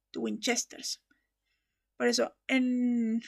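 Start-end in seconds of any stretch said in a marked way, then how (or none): 0.1-1.0 The Winchesters, stressed on CHES; stress on first E. Shown as a demonstration. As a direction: softly demonstrate The Winchesters with the accent on the first E. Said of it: "en la primera E".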